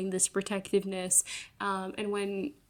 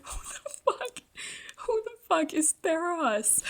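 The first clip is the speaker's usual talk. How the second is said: squeaky, much higher mock-confused squeal; mock disbelief; 9 semitones higher than usual. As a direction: replay her own baffled reading, cartoonish. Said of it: "I was like".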